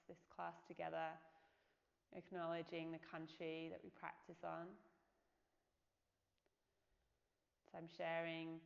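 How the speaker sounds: unhurried body-scan meditation voice with long pauses; guided meditation. Real, not acted. Yoga teacher leading listeners through a body scan, calm meditation voice. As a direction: guide them gently into the body, leaving space between phrases.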